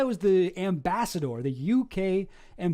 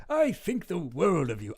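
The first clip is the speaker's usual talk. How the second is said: lightly put-on upper-class English accent; imitating a posh British politician, lightly; mock posh British voice. Streamer reading a politician's email in a mock posh British accent. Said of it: "I just assume he talks like this".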